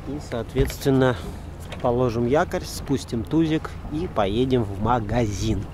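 ordinary talk

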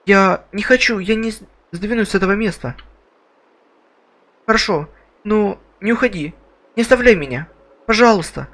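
put-on scared girl voice. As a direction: play the frightened girl begging.

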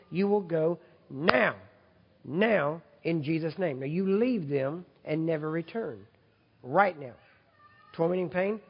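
loud, forceful rebuke of pain; preacher rebuking pain at full force; commanding rebuke. Real, not acted. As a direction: drive the pain out with authority. Rebuking pain in healing prayer.

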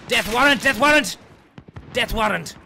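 high frenzied yelling; alarm shout. Real, not acted. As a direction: scream it in a frenzy.

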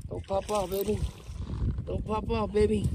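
usual speaking voice

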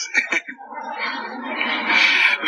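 amused laugh after the punchline; real laughter. Real, not acted. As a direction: laugh at the absurdity. (γέλιο με την ψυχή του)

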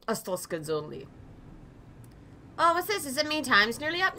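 ordinary delivery

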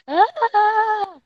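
high loud yelp; startled yelp. Real, not acted. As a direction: yelp when caught. Caught in game.

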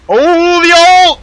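high, loud hype yell; excited shout. Real, not acted. Player hyping a teammate's ult, excited shout. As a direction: yell it at full pitch, thrilled by the play. Excited reaction to a game play.

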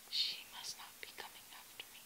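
fast fearful whisper; hurried hushed aside. Acted. Hiding princess whispers.

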